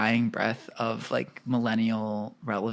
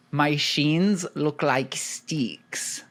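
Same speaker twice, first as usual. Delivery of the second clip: put-on slow, drawn-out Australian accent; much slower than usual. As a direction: quote it in a broad Australian twang, drawn out. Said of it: "an Australian accent".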